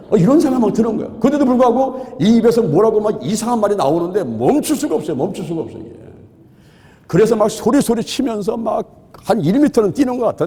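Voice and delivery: usual speaking voice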